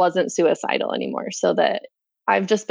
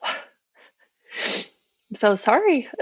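slow, sighing, teary apology; tearful apology; 4 semitones higher, much slower than usual. Real, not acted. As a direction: apologize through held-back tears. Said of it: "Sorry, I'm getting emotional".

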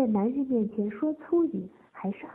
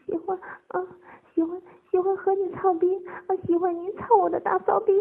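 high, halting, louder confession; trembling voice; 10 semitones higher, 6 dB louder than usual. A narrator's reading. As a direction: stammer it out between gasps. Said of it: "颤声说道".

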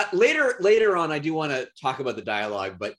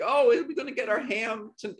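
higher-pitched bad Christmas Carol urchin; mock a terrible stage accent, pitched up; Tiny Tim; 8 semitones higher than usual. Podcaster mimics an actor's bad accent, high mock urchin voice. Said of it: "like Tiny Tim".

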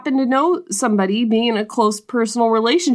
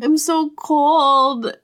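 high, slow sick whimper; feverish whine; 6 semitones higher, much slower than usual. Retold or imitated. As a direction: whine it out like a shivering patient. Feverish girl whining.

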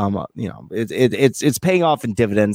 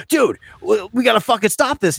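urgent; 10 semitones higher than usual. Retold or imitated. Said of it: "he's like".

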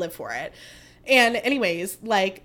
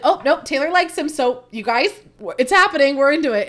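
higher and louder, bubbling with excitement; excited friend; 5 semitones higher, 8 dB louder than usual. Retold or imitated. Friend telling the group Taylor likes him, excited. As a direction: gush like the news just broke. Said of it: "And then she's like".

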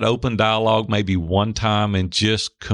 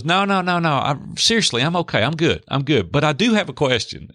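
insistent, higher-pitched wife voice; retold reassurance, pitched up; lightly imitated wife; 6 semitones higher than usual. Voices wife insisting she is okay.

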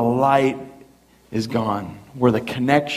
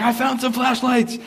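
excited dad; 10 semitones higher than usual. Retold or imitated. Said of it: "my dad's like".

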